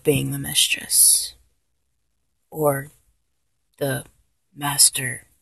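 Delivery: breathy confiding whisper, almost voiceless. Acted. To the listener, sharing a secret preference, whispered. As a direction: lean in and whisper the confession.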